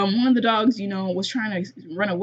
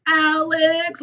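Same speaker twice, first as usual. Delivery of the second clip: high, loud drawn-out name call; mock screaming kid; 7 semitones higher, 4 dB louder, much slower than usual. Imitating Ellie screaming for Alex, mock yell. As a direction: call out like a whiny kid, exaggerated. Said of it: "she keeps screaming and shit".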